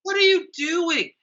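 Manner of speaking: high incredulous cry. Real, not acted. Watching strangers fight by a cliff, incredulous high cry. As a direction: shoot it up high in disbelief.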